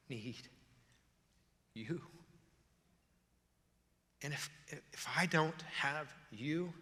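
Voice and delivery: hushed, halting plea with long pauses; quiet desperation. Acted. Psalmist telling God of his need, hushed desperation. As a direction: start near a whisper, word by word, desperately. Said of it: "the desperation of the situation".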